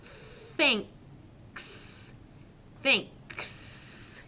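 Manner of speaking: Thanks said slowly, tongue between the teeth for the th, ending on a crisp k and s; clearly modelled Thanks; careful thanks. Shown as a demonstration. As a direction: model Thanks for children to copy, th then ks. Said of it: "Let's try it together".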